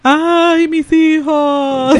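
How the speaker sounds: drawn-out, high, loud ghostly wail; mock La Llorona lament; wailing lost soul. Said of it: "¿cómo son las almas en pena?".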